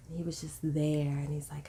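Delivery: ordinary talk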